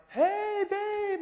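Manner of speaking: very high, hammed-up greeting; sweet greeting. Late husband greeting his wife at the airport, over-sweet.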